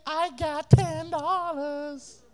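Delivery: put-on slow, far-off kid's boast; bragging boy. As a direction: show off the money slowly.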